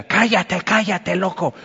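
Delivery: acted irritated shushing, pitch jumping up; harsh hushing. Crowd ordering the blind beggar to shut up, harsh hushing.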